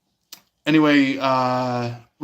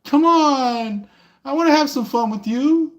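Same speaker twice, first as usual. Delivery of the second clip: much higher, pleading tease; flirty come-on voice; 13 semitones higher, 4 dB louder than usual. Retold or imitated. Tarot reader mimics someone begging for fun. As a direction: coax them with a high sing-song come-on. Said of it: "going like".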